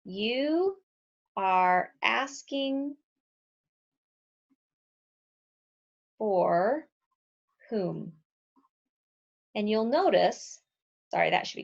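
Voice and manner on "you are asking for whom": drawn out, word by word, long pauses; slow teaching read with pauses; slow deliberate rewrite. Shown as a demonstration. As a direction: spell it out slowly for the class. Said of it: "we can actually rewrite this sentence".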